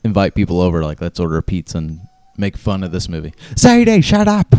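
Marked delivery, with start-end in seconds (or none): 3.5-4.5 high, loud scolding yell; shouting at dog. Real, not acted. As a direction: snap at the whining dog.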